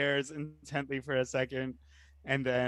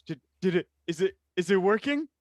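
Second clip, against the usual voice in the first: eager test; 6 semitones higher, 3 dB louder, faster than usual. Acted. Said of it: "you can hear in your mind".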